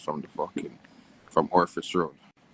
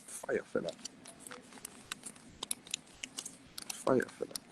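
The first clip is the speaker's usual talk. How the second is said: whispered praise; 4 semitones higher, 13 dB quieter than usual. Real, not acted. Muttered praise, unvoiced.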